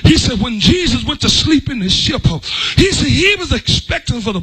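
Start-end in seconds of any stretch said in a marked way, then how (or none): none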